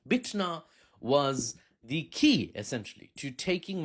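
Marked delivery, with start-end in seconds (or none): none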